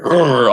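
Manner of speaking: loud mock grumble of frustration; imitated exasperated growl. Journalist shaking his head at the fan, imitated frustrated growl. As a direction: growl it out, mimicking his annoyance. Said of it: "shaking his head and was just like".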